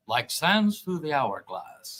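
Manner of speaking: low, slow, hushed announcer; dramatic soap opera voice. Pretend. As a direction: intone it like a soap opera opener. Soap opera intro line.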